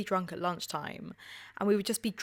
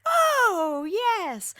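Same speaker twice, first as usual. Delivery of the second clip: put-on delighted old lady; 11 semitones higher, 7 dB louder than usual. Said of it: "she was like".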